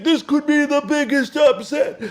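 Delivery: high, loud, cracking hype-announcer voice; Dickie V impression. Host mimics Dickie V hyping the upset, high and loud. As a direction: sell the upset like a frantic old TV analyst. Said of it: "I'll try to do his voice now".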